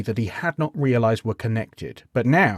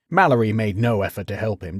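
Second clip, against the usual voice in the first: usual speaking voice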